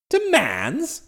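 mildly indignant rising query; taken aback. Acted. Master queries his valet's choice of word, taken aback. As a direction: echo the word in surprise.

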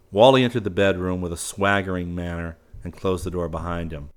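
plain reading voice

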